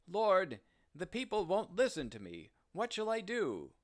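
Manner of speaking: a helpless man begging for answers; desperate plea. Acted. Noah desperate, calling on God.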